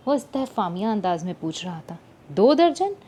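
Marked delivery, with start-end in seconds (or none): 2.3-2.9 high, louder, disbelieving दर्जन rising; incredulous yell. A narrator's reading. Girl yelling in disbelief at her cousin. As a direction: shout दो दर्जन back in disbelief. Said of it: "इसी अंदाज में चिल्लाई".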